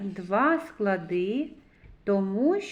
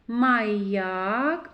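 Маяк with a long rising ЯК; drawn-out second А. Shown as a demonstration. Teacher picks out the vowel, second А drawn out. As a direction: stretch the А after Й in Маяк. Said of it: "а голосний".

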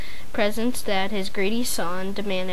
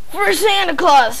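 louder, higher nasty snarl; 6 semitones higher, 5 dB louder than usual. A narrator's reading. Wicked boy answering his father, snarling. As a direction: spit it out viciously. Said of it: "snarled Bradley".

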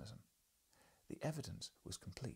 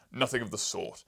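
loud, severe professor rebuke; 11 dB louder than usual. Acted. Professor correcting the journalist, loud and severe. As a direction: snap it down like a scornful scholar. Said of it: "said the professor severely".

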